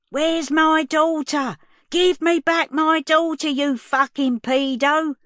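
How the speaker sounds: high, loud, slow frantic scream; desperate mother screaming for her daughter back. Acted. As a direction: scream it through the glass at him.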